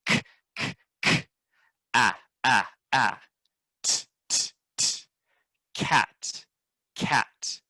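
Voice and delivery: whispered "k" and "t", voiced "ah", then "cat"; sounding out cat. Shown as a demonstration. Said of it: "together we go".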